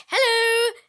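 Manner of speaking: very high, drawn-out hello; high mock-sweet greeting; acted flirty greeting. Nurse greeting Lance, acted.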